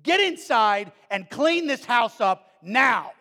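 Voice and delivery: loud, slow furious yell; screaming mom. Retold or imitated. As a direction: scream it like an enraged mother. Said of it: "his mom comes outside screaming and yelling at him".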